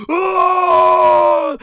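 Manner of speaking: very loud, high, sustained scream; battle scream. Acted. Taunted fighter swinging at Vegeta, screaming. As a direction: let out a full-voiced attack cry.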